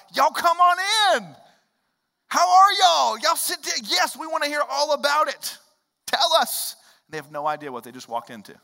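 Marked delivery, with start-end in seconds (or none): 0.1-6.7 high, loud, hammed-up Southern welcome; a giddy host shouting everyone inside; overeager host. Preacher mimics greeting Jehovah's Witnesses, high loud hammed-up welcome.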